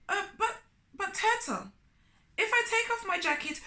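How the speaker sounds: high, fast stammering plea; flustered Anansi. Acted. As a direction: stammer in a panic. Anansi panics about jacket.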